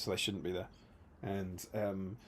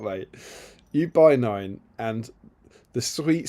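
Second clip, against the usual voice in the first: ordinary talk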